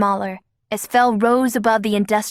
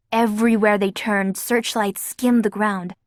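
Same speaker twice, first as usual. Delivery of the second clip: ordinary delivery, nothing marked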